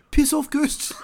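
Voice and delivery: high mock shout at ghosts; mock yell. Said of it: "you could be like".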